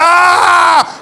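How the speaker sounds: long, high, loud wail; sudden sustained scream of fake pain; mock early cry. Shown as a demonstration. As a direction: burst into a put-on cry. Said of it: "then you don't cry when they hear".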